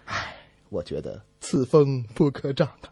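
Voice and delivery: ordinary talk, nothing marked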